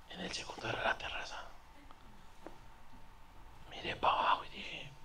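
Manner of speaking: sneaky whisper, barely voiced; hushed whisper. Sneaking away at night, hushed. (susurro sigiloso)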